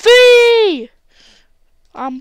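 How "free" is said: loud high cry of relief; triumphant shout. Real, not acted. Gamer shouting as his rider breaks free. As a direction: shout the word out in joy. Escaping in the game.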